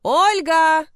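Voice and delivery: high loud calling yell; loud wail. A narrator's reading. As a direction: bawl her name out. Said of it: "вновь заголосил староста".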